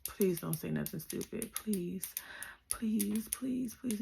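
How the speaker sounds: soft, breathy begging under the breath; pleading whisper. Retold or imitated. Teen girl silently begging her mom, whispered. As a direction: whisper a desperate plea. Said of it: "I was like".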